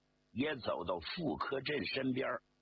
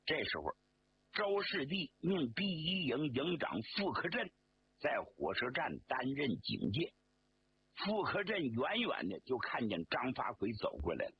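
plain reading voice

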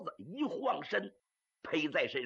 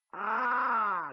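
startled cry; 3 semitones higher, 4 dB louder than usual. Acted. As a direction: cry out on seeing the pursuer. Startled on looking back.

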